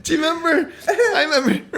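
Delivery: squeaky high laughter through words; can barely get it out for laughing; laughing hard. Real, not acted. Laughing hard while recalling.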